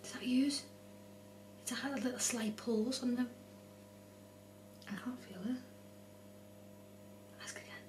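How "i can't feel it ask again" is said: nervous night vlog whisper. Real, not acted. Tense whisper at Ouija board.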